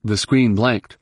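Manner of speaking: usual speaking voice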